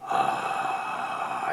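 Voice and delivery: unvoiced rattly ah; fry demo. Shown as a demonstration. Teacher demonstrating the fry, rattly ah. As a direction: let the ah crackle in fry. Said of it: "that death rattly sound of the fry".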